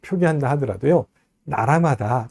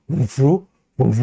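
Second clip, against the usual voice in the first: French feel. Shown as a demonstration. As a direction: give 봉주 a French accent. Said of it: "약간 좀 프랑스어 같은 느낌이 들죠".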